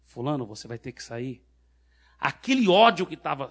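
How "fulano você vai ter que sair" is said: soft, lower-pitched order; very quiet command. Retold or imitated. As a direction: repeat the command softly, almost privately. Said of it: "Eu falei bem baixinho".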